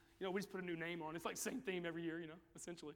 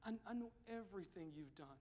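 lightly voiced God, hushed and grieved; quiet voice of God; 2 semitones lower, 6 dB quieter than usual. God quietly telling the straying believer he knows everything. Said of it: "He says".

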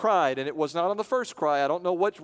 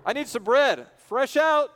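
higher, loud plea; calling out for bread; 9 semitones higher, 7 dB louder, slower than usual. Acted. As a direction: call through the door. Bread scene again.

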